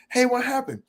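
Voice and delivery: high, innocent mock puzzlement; clueless question. Coming back to a partner who went silent, high and puzzled. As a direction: ask as if you have no idea. Retold text argument.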